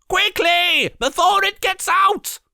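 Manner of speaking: high, loud urgent yell. A narrator's reading. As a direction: shout it in panic. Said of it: "he yelled".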